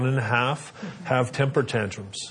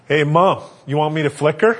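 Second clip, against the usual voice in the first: lightly voiced eager boy, laughing; a touch of a kid; 4 semitones higher, 7 dB louder than usual. Son offering to flick the baby, laughing a bit. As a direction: play the helpful little brother. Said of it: "Cole's in the car looking at her going".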